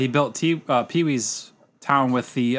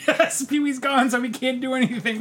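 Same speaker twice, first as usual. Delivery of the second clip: panicky high voice; 10 semitones higher than usual. Retold or imitated. Staff panicking without Pee Wee.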